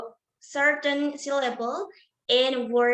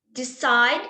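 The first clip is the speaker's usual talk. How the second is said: "decide" with a louder, rising second syllable; stress on last syllable; 5 dB louder than usual. Shown as a demonstration. Presenter repeating an example of last-syllable stress, "decide" stressed loudly at the end. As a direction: push hard on the end of "decide". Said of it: "Side is stress on last syllable".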